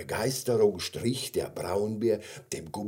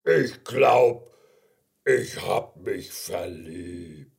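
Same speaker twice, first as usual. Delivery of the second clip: slow, halting bear voice; smitten bear; 4 dB louder, much slower than usual. Acted. Bear kissed by gummy bear, slow dazed confession. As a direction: confess it slowly, with pauses, as a bear.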